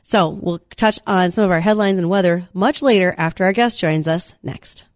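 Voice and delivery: normal talking voice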